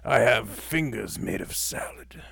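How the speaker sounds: slow creepy whisper; Salad Fingers voice. Retold or imitated. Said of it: "similar to Salad Fingers".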